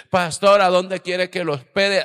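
loud, high eager call to the pastor; eager host calling out. Retold or imitated. Host calling to the visiting pastor, loud and eager. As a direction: call out brightly to the guest.

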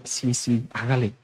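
soft, low encouragement; devil egging on. Acted. The devil cheering a believer who quits, softly egging on. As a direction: urge it on quietly, well under your voice. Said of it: "yo creo que aplaude".

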